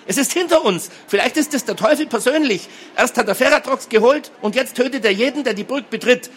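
high-pitched hysterical ranting; frantic wailing. A narrator's reading. Girl warning that the devil is behind them, wailing. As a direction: rattle out the fears in a high panic. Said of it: "zeterte die dreizehnjährige".

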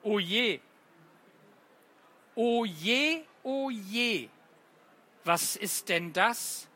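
lightly acted worried sighs, then hesitant replies; poem recital. Teacher reads the confession poem to children, lightly acted. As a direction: play the worried "oh je" against the sheepish "nun ja".

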